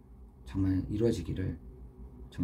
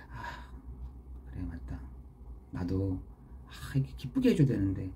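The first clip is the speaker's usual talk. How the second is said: soft musing to oneself; quiet realization; 3 semitones lower, 7 dB quieter than usual. Acted. Preacher voicing his own inner realization to the congregation, quiet self-talk. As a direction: think aloud under the breath.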